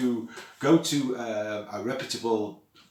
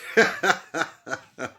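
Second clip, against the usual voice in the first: real laugh; 8 semitones higher than usual. Real, not acted. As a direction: burst of incredulous laughter. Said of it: "I don't know why I'm laughing".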